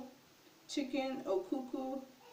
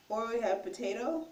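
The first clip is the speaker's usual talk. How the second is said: ordinary talk, nothing marked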